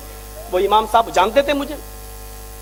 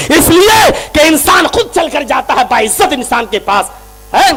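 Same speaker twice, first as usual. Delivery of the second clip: loud, higher preaching at full force, building to shouts; fiery preaching; 5 semitones higher, 6 dB louder than usual. Acted. Preacher rebuking the crowd for summoning the Prophet, fiery shouting. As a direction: hammer the point home to the crowd.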